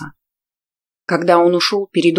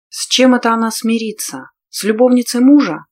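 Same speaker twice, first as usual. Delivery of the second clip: indignant; 5 semitones higher, 4 dB louder than usual. A narrator's reading. Heroine's indignant inner questions.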